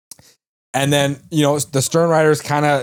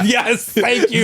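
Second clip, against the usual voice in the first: high slow silly voice; a slow high mock character voice; put-on voice; 9 semitones higher, much slower than usual.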